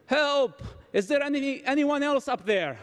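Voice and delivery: high-pitched mock cry for help; mock scream. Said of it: "screamed back and said".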